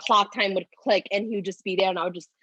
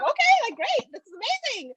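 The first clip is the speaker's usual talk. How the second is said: high, thrilled squeal; mock delighted gush; excited. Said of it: "and I was like".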